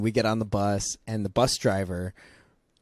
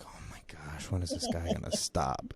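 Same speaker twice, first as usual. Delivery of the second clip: muttered complaint; 5 semitones lower, 11 dB quieter than usual. Retold or imitated. Bus riders rolling eyes, grumbling.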